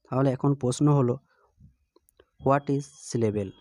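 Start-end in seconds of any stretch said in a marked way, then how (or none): 2.5-3.5 very slow, each word spaced out; deliberate teacher question, dictated slowly; slow dictation. Shown as a demonstration. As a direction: spell the question out slowly for the class. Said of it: "teacher posing the question".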